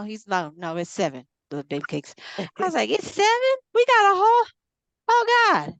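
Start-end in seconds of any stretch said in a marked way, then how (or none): 2.9-5.7 very high, loud squeal of joy; giddy relief. Retold or imitated. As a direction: shriek like you got a gift. Relief it's only seven.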